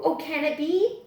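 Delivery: ordinary delivery, nothing marked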